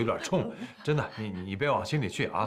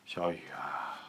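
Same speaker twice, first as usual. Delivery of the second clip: whispered, softer name call; 6 semitones lower, 7 dB quieter, slower than usual. Acted. Father whispers his son's name before scolding, whispered. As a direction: breathe his name without voice. Father quietly addresses son.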